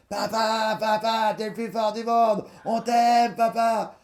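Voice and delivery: put-on high, loud cries of his boys in the night; sons calling out. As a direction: call out like children shouting to their father. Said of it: "j'ai entendu".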